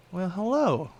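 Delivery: high, purring mock-friendly hello; sly villain greeting. Viper woman greeting the hero, sly and high. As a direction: greet him like a cat toying with prey.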